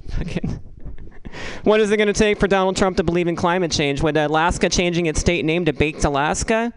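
ordinary talk, nothing marked

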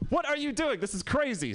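high-pitched comic disbelief; mock appalled outcry. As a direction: squeal the question, still high on the verdict. Appalled reaction acted out.

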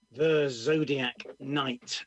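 title given slowly and loudly; slow title announcement. Real, not acted. Poet announcing the poem's title, slow and loud. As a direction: announce "The Zodiac Knight" slow and loud, word by word. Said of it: "this one is called".

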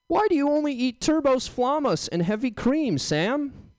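high, incredulous voice asking why; mock a prying woman; high-pitched mock interrogator; high nagging questioner. Voiced questioner in his bit.

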